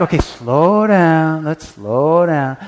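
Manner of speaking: slow each word down on purpose; calm yourself and slow the prayer down; slow, deliberate. Shown as a demonstration. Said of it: "it's like".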